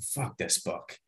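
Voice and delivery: muttered low and soft under the breath; under his breath. Retold or imitated. Passenger muttering at a morbid book, under his breath. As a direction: mutter it so nobody near hears. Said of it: "I literally said that under my breath".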